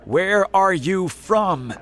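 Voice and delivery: loud, high, slow questioning shout; police officer calling up a demand. A narrator's reading. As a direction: shout it slowly at a distant stranger. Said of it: "shouted the police officer".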